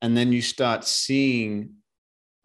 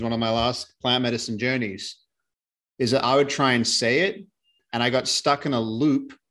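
ordinary delivery

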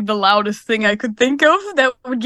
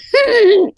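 imitated loud, high shallow gasp; shallow wheezing breaths; 9 semitones higher, 6 dB louder than usual. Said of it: "shallow breathing. It was like".

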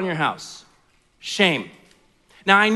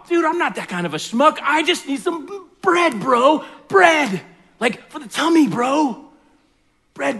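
frat bro; 3 semitones higher than usual. Acted. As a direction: yell like a hyped frat bro at midnight. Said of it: "this is how the story goes".

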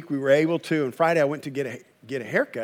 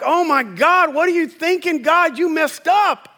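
high, whiny exasperated cry at God; mock exasperation; 9 semitones higher than usual. Disillusioned spouse blaming God, high whine. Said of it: "now you're like".

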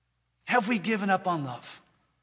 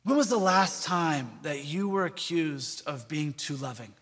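ordinary talk

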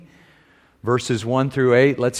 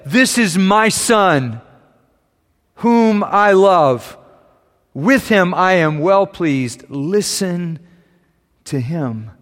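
higher, slow, solemn heavenly voice; voice from the cloud; 5 semitones higher, 4 dB louder, slower than usual. A narrator's reading. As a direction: proclaim it slowly from above. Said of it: "a voice from the cloud said".

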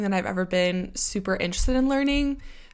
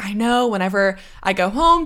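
usual speaking voice

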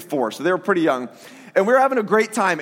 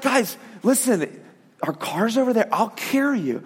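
gentle comfort; 3 dB quieter than usual. Retold or imitated. Father comforting boys in storm.

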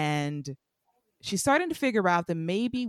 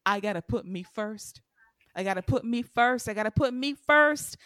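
building declaration; 3 semitones higher than usual. Retold or imitated. Trina quoting Cookie to Spencer, building declaration. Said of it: "like Cookie told Lucius".

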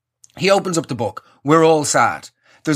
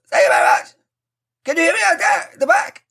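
projected as if to the back rows; mocking big-concert announcer call; mock stadium voice; 11 semitones higher than usual. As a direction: play to a huge arena crowd. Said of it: "You know that sort of voice people use?".

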